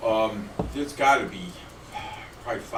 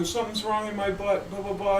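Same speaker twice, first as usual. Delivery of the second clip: high, whiny cry for help in a joke; playing the moaning partner, pitched way up; whining pained partner; 10 semitones higher than usual. Joke teller voicing the hurting partner, high and whiny. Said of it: "So he goes".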